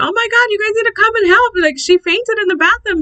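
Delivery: high, rushed re-enacted emergency call; urgent alarm. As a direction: call urgently for help, pitch jumping up. Retold emergency call for help.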